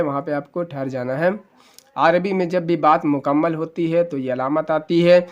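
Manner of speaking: ordinary talk, nothing marked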